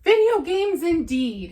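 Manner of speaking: upset. Acted. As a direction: spit out "Video" with disgust. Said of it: "becoming more and more upset".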